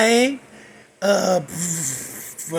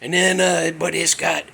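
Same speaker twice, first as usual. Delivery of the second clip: ordinary talk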